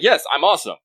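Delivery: normal talking voice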